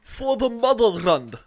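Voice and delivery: slow, high, loud Russian cry; hammed-up Russian rallying cry; Russian accent. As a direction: proclaim it drawn-out in a mock Russian accent. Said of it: "hear your Russian accent".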